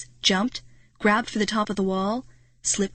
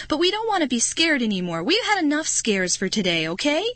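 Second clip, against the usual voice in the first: shrill blurt; 6 semitones higher than usual. Acted. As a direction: plead shrilly to stop the scares. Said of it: "Marty blurted out shrilly".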